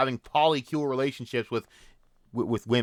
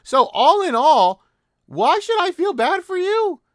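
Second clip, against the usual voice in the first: incredulous shout; 6 dB louder, slower than usual. Real, not acted. Outraged rant at an abuser.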